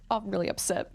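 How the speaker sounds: ordinary talk, nothing marked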